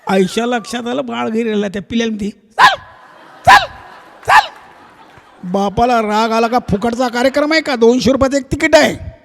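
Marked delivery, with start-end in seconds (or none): 2.3-4.5 very high, louder yelps; high yapping dog calls. Acted. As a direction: call the pup with sharp yips. Mother dog calls the pup.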